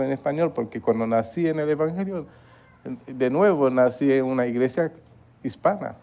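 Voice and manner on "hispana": ordinary talk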